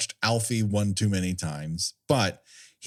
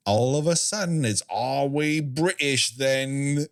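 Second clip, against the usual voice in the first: mock British accent; 3 semitones higher than usual. Mocking a singer's affected accent, exaggerated British. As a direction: slip suddenly into a put-on British accent. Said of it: "she affects a British accent".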